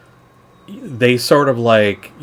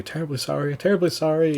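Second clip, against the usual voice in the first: higher, hurried sorry from a fleeing robber; profuse apology; 6 semitones higher, 5 dB quieter, faster than usual. Acted. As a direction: apologize over and over while backing away. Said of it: "apologizing profusely".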